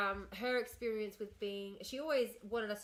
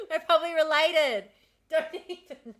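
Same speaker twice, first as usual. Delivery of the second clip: loud, high, through real laughter; giggly and thrilled; laughing excitement; 6 semitones higher, 10 dB louder than usual. Real, not acted. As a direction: crow it loudly between laughs. Laughing with a possible cousin.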